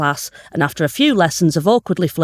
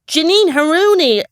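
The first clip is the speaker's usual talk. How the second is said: a much higher, louder, hammed-up American accent on "Janine Haruni"; 11 semitones higher, 4 dB louder than usual. Shown as a demonstration. Co-host demands the accent, so a hammed-up American accent. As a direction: put on a big American accent for the name. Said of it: "Do it in the accent, come on".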